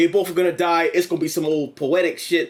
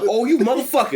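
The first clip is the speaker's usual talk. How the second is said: mock outraged villain; 5 semitones higher than usual. Michael Myers discovers bare head.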